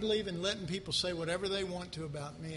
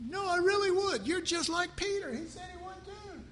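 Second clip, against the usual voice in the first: higher, indignant protesting churchgoer; mock protest; 8 semitones higher than usual. Pastor playing a churchgoer arguing with God, pitched-up protest. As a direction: play both sides of a squabble, pitched up. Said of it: "You go".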